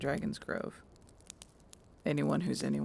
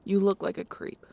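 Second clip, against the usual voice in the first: louder, annoyed put-down; irritated scolding; 5 semitones higher, 8 dB louder than usual. Acted. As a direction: snap it at her in disgust. Bex scolding Briar.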